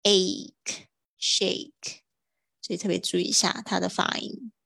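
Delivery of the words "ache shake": "ache" and "shake" drawn out very slowly with spread smiling lips; teacher modelling the "shake" vowel slowly; slow model pronunciation. Shown as a demonstration. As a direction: smile and stretch the "ache" in "shake". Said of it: "嘴巴就是会拉长，然后微笑发出那个声音".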